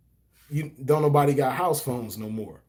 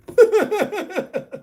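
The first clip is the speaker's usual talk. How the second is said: high laugh; 5 dB louder than usual. Real, not acted. Laughing at grandma story.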